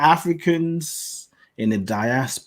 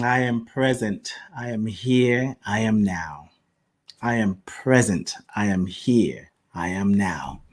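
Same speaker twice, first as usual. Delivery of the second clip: soft, low, steady mantra; calm affirmation; 3 semitones lower, 4 dB quieter than usual. Acted. Host leads listeners in a grounding affirmation, soft and calm.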